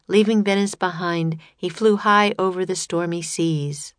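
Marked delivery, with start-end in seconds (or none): none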